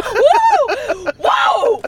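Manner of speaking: loud, high whooping shout; hyped-up whoop. Pretend. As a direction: whoop like a rowdy hype man. Hyped-up whooping while clowning around.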